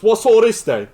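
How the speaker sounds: loud, high mock British Knuckles; hammed-up British accent; British accent impression. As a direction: ham up a posh British line. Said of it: "and he goes".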